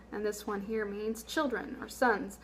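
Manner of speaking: usual speaking voice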